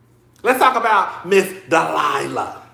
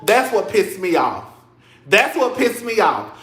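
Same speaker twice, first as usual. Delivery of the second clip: ordinary talk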